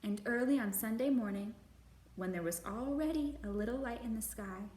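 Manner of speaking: put-on gentle, measured read-aloud for children; storybook narration. A narrator's reading. Librarian reading a picture book to kids, gentle narration.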